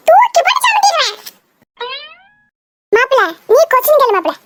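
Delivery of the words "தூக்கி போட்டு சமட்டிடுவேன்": high-pitched threatening outburst; worked-up, high warning; angry threat. Acted. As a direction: threaten with a sharp rise in pitch. Pupil threatens in acted quarrel.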